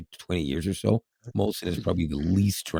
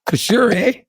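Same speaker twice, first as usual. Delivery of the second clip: put-on Canadian accent; 13 semitones higher, 7 dB louder, slower than usual. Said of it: "you're just being a hoser, eh?".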